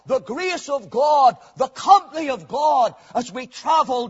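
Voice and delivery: rhythmic, ringing pulpit litany; fervent preaching. Real, not acted. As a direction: hammer out each phrase to the congregation. Preaching at full force.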